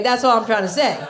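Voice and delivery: ordinary delivery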